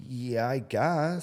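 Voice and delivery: deadpan shrug. Retold or imitated. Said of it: "And I'm like".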